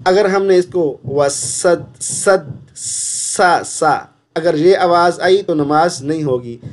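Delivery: ordinary talk, nothing marked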